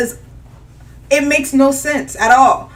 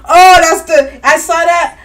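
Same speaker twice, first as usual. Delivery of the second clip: worked up; 4 semitones higher, 6 dB louder than usual. Real, not acted. Outraged over funding freeze.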